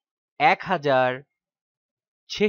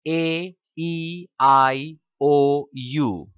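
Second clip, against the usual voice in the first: each vowel held very slowly; vowels drawn out; 4 dB louder, much slower than usual. Shown as a demonstration. Teacher listing the five vowels, very slowly. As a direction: stretch every vowel out one by one. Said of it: "এই পাঁচটা vowel".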